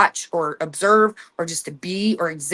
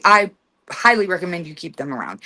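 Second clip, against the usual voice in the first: normal talking voice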